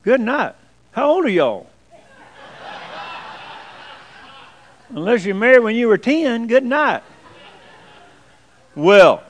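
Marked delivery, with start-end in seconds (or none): 0.0-7.0 higher, incredulous exclamation; amazed. Real, not acted. Preacher to long-married couples in the congregation, amazed. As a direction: react in real astonishment.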